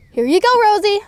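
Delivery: ordinary delivery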